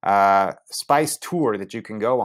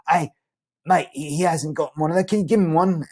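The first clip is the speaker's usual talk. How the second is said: higher, eager mate voice; excited friend; 7 semitones higher than usual. Retold or imitated. Friend Brian urging the narrator, lightly voiced high eager mate. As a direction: play the friend, keen and high.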